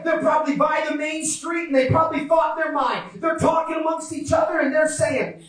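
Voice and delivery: high, driving preacher delivery; fervent preaching. Real, not acted. Preacher telling of blind men on alert, high urgent preaching. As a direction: press on with the story, impassioned.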